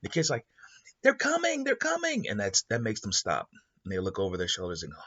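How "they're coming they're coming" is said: put-on very high, alarmed child cry; a scared kid sounding the alarm; frightened warning. Kid warning the arguing pair that robbers are coming, very high alarmed cry.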